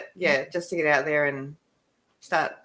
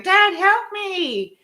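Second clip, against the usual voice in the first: high, drawn-out cry for help; panicked call for dad; 12 semitones higher, 5 dB louder, much slower than usual. Retold or imitated. Teen racing past the house yelling to dad, high panicked call. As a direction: stretch it out high, calling for Dad. Calling to dad while crashing.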